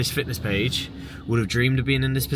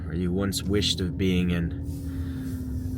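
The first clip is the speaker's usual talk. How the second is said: normal talking voice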